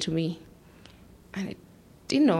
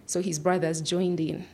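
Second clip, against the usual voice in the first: usual speaking voice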